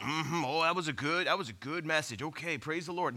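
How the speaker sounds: low, softening murmur of mild approval; nodding-along congregation. Retold or imitated. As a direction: imitate polite churchgoers agreeing without much thought. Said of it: "we all went".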